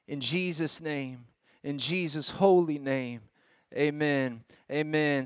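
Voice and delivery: hushed, reverent public prayer. Real, not acted. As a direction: pray quietly and unhurriedly, with long pauses between phrases. Let me pray before I kinda get started.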